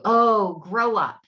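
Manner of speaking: put-on nagging adult telling a kid off; imitating a scolding grown-up. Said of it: "adults start squashing that out of you by telling you".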